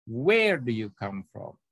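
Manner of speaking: wh-question led by a strong WHERE; stress on WHERE. Shown as a demonstration. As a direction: punch "where", then drop the ending. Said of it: "tekanannya tetap di akhirnya turun".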